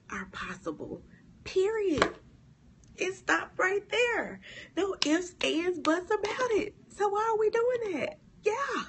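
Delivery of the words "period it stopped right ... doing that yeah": much higher, louder fervent exclamations; fervent preaching. Real, not acted. Preacher fired up, driving the point home to viewers. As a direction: preach it with rising fire.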